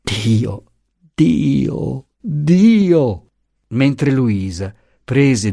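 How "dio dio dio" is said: a kneeling father's breaking sob; sobbing cry. Acted. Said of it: "si inginocchiò singhiozzando". (grido singhiozzante di un padre in ginocchio)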